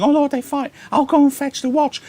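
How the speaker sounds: put-on high, alarmed page boy voice; high panicky page; frightened page. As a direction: squeak it out high like a scared boy. Page voiced watching the fight.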